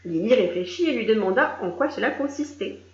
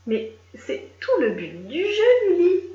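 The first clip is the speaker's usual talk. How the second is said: exasperated captain; 8 semitones higher, 4 dB louder, slower than usual. A narrator's reading. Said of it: "lui expliqua le capitaine".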